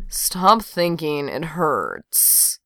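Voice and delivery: slow, soft hungover mumble; mumbled. A narrator's reading. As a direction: mumble it half asleep. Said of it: "Johnny mumbled into her neck".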